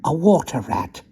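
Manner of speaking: water rat voice. Acted. Rat character voice.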